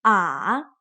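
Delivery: "Ah" low dip then rise; third tone. Shown as a demonstration. Teacher repeats the dipping third tone. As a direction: dip "Ah" and come back up. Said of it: "goes down and then up again".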